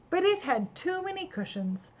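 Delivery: ordinary delivery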